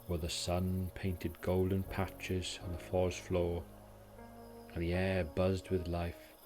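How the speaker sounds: ordinary delivery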